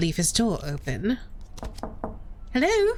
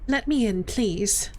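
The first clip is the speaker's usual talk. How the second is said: ordinary talk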